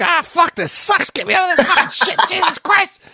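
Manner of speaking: high-pitched panicked yelping, played for laughs; comic high-pitched outburst of cursing; acted freak-out. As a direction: imitate someone freaking out in a high, strained voice. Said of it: "he's, like, freaking out".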